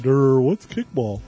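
slow, dopey "Durr, what's kickball?"; mock dumb voice. Reader mocking a sister who acts dumb at sports, slow dopey voice. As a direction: play it like a clueless airhead.

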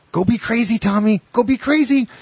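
high, loud call to Tommy, like to a pet; mock call to Tommy. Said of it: "He goes".

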